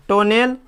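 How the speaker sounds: टोनेल as "toh-nell"; wrong spelling reading टोनेल; mispronunciation demo. Shown as a demonstration. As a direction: say टोनेल with "o" first and a clear "e" before l. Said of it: "तो ये गलती हो जाएगा".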